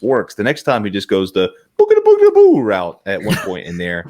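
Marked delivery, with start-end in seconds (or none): 1.8-2.6 loud, high cartoonish "boogada" noise; silly imitated possession sound; mimicked monster babble. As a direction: rattle it off and swell into a big "boo".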